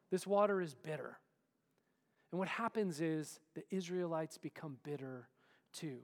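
ordinary delivery

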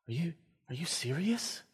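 soft, incredulous question; softly imitated disbelieving student; hushed disbelief. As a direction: ask it quietly, as if stunned.

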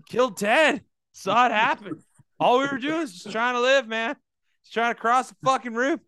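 a squirrel griping about a lost friend; indignant squirrel. Pretend. Squirrel to the others about the gunman, loud indignant voice.

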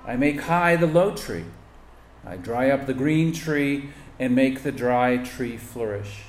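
usual speaking voice

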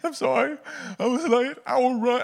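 very high, hammed-up weepy voice; mock tearful apology. Player apologizing to his coach, mock tearful. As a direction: plead in a comic crying squeal. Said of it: "I just had tears streaming down my face".

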